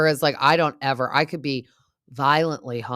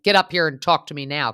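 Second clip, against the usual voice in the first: retold yell; 2 semitones higher, 8 dB louder than usual. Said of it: "he's yelling for Jax".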